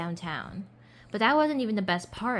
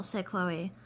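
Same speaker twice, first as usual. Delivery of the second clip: ordinary delivery, nothing marked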